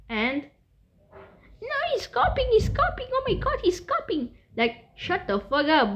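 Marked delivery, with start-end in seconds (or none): 1.6-4.3 very high, squeaky mocking imitation; high-pitched mock outrage; whiny hater. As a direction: mimic a tattling hater in falsetto. Mimics hater accusing him of copying.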